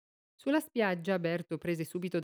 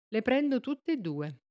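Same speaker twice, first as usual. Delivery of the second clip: offhand; 6 semitones higher, slower than usual. A narrator's reading. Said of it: "disse quello con disinvoltura".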